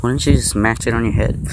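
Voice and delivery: usual speaking voice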